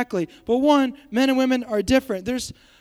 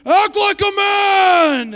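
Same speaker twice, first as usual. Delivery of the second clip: high, very loud, drawn-out bellow; mock movie shout; 9 semitones higher, 8 dB louder, much slower than usual. Shown as a demonstration. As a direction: yell it slowly, hammed up. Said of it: "But you can be like".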